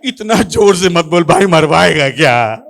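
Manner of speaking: loud, drawn-out rebuke; exasperated scolding. Retold or imitated. Soldier rebuking the loud boy, exasperated scolding. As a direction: scold him loudly, stretching the end.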